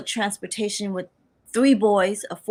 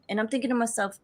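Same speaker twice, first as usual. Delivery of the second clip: ordinary talk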